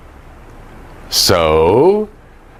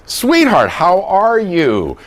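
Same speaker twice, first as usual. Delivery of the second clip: sweet, slow greeting; 7 semitones higher, slower than usual. Shown as a demonstration. Showing gentle greeting.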